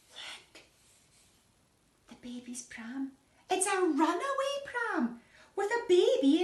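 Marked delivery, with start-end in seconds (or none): 0.1-3.1 breathless hushed look; whisper. Acted. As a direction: point it out in a secret whisper. Gasped discovery to the children.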